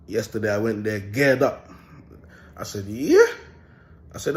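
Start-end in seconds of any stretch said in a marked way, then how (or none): 2.9-3.3 high, loud burst of yeah; defiant retold yeah. Retelling a standoff with the family, loud and high. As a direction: fire it back high and loud.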